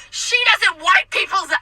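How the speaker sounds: high, slow outraged wail; mock screaming. Customer ranting about server as coworkers watch, screaming. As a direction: imitate a customer's screamed complaint. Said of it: "she's screaming about me".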